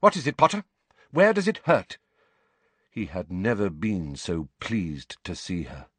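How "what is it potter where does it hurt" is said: high, louder, urgent questioning; brisk professor. Acted. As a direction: question a sick pupil briskly. McGonagall briskly questions the patient.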